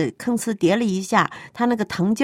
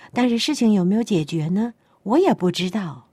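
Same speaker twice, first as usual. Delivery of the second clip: ordinary talk, nothing marked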